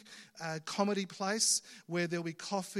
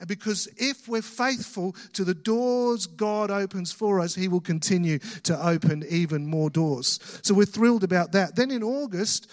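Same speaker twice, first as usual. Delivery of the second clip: rousing preaching; 7 dB louder than usual. Real, not acted. Pastor rises to preaching pitch.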